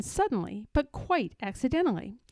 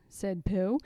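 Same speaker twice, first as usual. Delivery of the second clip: ordinary delivery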